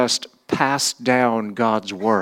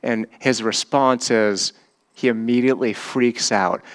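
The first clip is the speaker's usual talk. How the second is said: ordinary delivery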